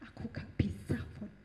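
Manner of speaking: whispered refusal; mother's whisper. Retold or imitated. Mother answering her daughter, a whispered refusal. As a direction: breathe the refusal unvoiced. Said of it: "mama saya bilang".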